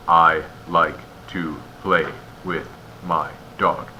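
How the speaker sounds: slow, a gap after every word from "I" to "dog"; isolated words, "I... like... to... play... with... my... dog"; too many pauses. Shown as a demonstration. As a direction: say it word by word, pausing between each. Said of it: "You also don't want too many pauses".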